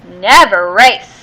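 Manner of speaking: usual speaking voice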